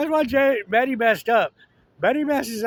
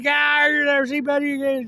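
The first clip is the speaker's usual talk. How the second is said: dad shouting; 6 semitones higher, 4 dB louder, faster than usual. Retold or imitated. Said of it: "Her dad said".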